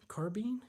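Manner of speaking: high, rising "carbine" ending like bean; carbine as car-been. Real, not acted. Streamer doubts chat's answer, carbine as car-been. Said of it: "it's bean. Really?".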